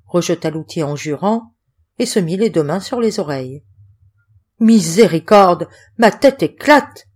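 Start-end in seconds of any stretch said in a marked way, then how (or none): 4.6-7.1 loud, slow, pained cry. A narrator's reading. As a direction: cry out, clutching a splitting head. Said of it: "s'écria-t-il". (cri de douleur, fort et lent)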